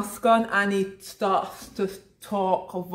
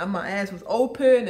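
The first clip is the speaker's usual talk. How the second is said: ordinary talk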